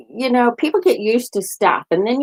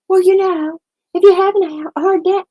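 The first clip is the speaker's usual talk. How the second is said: much higher, mocking doctor's voice; 11 semitones higher, 3 dB louder than usual. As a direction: parody the doctor's condescending advice. Mock doctor advising tranquilizers.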